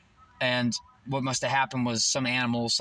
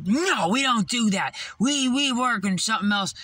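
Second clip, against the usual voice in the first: lightly mocked manager; 7 semitones higher, 3 dB louder than usual. Manager correcting him at work, mock scolding. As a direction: imitate a disapproving boss, pitched up.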